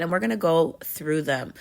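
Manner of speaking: usual speaking voice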